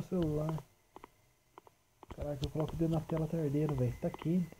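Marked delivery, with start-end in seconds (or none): none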